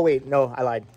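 ordinary talk, nothing marked

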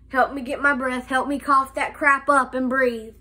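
ordinary delivery

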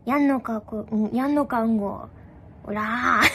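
playful demo of an online thug; mock net yankee. Shown as a demonstration. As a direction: pick a fight like a fake tough. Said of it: "みんなやってみて".

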